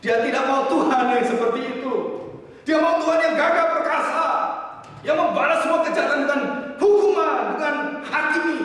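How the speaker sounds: high, loud sermon climax; full-force preaching. Real, not acted. Preacher tells of wanting a mighty, punishing God, full-force preaching. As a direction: drive each phrase out at a shout.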